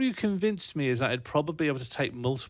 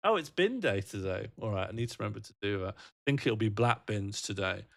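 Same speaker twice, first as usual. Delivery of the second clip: ordinary delivery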